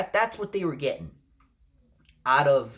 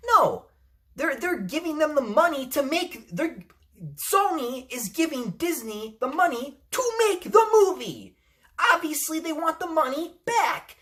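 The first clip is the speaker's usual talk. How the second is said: heated rant; 10 semitones higher than usual. Real, not acted.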